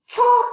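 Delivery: big high chomp sound; loud chomp. A narrator's reading. Prairie dog gobbling apple, loud high chomp. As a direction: snap out a loud bite noise.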